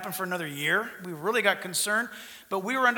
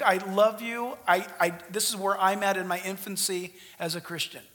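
usual speaking voice

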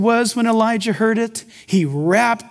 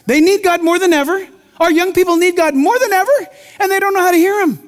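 high, loud urgent preaching; preaching at full force; 9 semitones higher, 5 dB louder, faster than usual. Acted. Pastor pleading for young people, loud urgent preaching. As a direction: drive it home with passion.

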